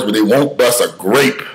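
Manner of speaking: normal talking voice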